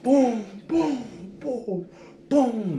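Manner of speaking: high, quick booms for each punch; mock punch sounds. Recounting the jail fight, he mimics each punch with booms. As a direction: beat out the blows with your voice.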